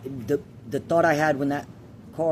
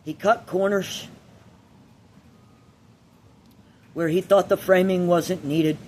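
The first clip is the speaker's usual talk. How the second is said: louder, higher, emotionally charged parable telling; parable storytelling; 6 semitones higher, 7 dB louder than usual. Acted. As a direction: tell the fable with swelling emotion.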